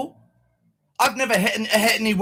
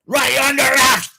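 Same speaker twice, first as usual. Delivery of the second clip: mock cop voice, loud and higher; officer's tone; 6 semitones higher, 6 dB louder than usual. Mimicking the officer's arrest line, loud. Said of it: "The way he said".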